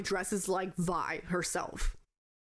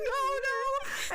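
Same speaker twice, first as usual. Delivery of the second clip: high, squealed refusal; playful, wailing pretend terror; mock scream. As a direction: imitate a panicked refusal to go near it. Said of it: "like, screaming".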